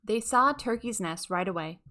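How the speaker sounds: ordinary delivery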